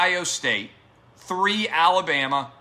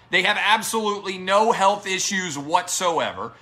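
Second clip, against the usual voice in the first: normal talking voice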